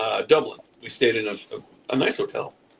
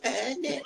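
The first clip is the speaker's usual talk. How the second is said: high-pitched, softer mimicked squeak; wordless bellboy noise; 12 semitones higher, 8 dB quieter than usual. Bellboy not understanding the plunger request, high soft noise. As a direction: imitate his uncomprehending little noise. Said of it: "He goes".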